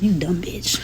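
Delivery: ordinary delivery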